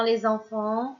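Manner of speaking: ordinary delivery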